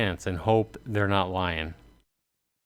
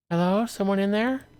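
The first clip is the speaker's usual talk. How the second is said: pitched-up woman calling into house; high stranger calling; 12 semitones higher than usual. Acted. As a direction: call out hopefully. Voicing a new stranger.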